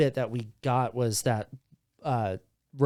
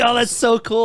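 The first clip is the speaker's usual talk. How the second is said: high, bright gushing delight; excited; 8 semitones higher, 4 dB louder than usual. Retold or imitated. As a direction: gush like a thrilled fan. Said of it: "so excited".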